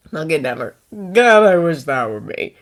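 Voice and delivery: ordinary talk, nothing marked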